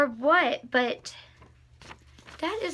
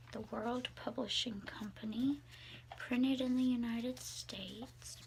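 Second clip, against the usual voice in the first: whispered reading; 3 semitones lower, 11 dB quieter than usual. Real, not acted. Whispers the Bible's title page to viewers. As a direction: hush it like reading in a library.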